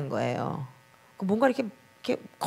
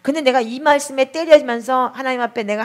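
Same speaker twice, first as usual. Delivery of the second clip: ordinary delivery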